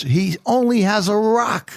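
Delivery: put-on high, slow child's protest; doubtful little boy. Grandson doubting David's rock, high and slow. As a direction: point out the obvious like a kid.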